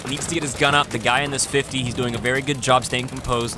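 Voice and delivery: ordinary talk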